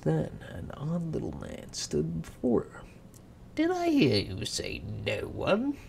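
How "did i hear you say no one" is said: higher, sly sneering imp; giggly sneering imp. Acted. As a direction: slither in with a smirk. Imp appears.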